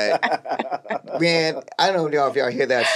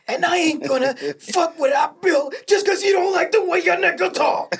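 Idris Elba impression. Imitating Idris Elba's big emotional scene, high crying shout. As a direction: wail it out like a dramatic TV scene. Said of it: "That was good".

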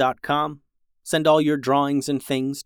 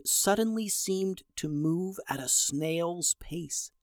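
soft, drawn-out storytelling; slowed-down narration; 4 semitones higher, 8 dB quieter, slower than usual. A narrator's reading. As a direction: stretch it out quietly, like slow motion. Narrating time slowing to a crawl.

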